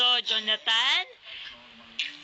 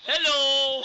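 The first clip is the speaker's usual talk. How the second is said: louder, higher sing-song hello; mock cheerful hello; 4 semitones higher, 5 dB louder than usual. Mother imitating the uncle to make the child laugh, loud hello. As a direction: belt a jolly greeting.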